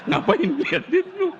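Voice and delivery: high, fast laughing parrot taunt; mock the visitor like a cheeky parrot. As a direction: parrot sassing a staring visitor.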